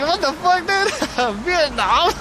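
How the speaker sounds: high, laughing disbelief; can't believe what she just said; cracking up in disbelief; incredulous disbelief. Retold or imitated. Disbelief at lady's veteran claim.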